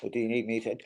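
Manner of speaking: ordinary delivery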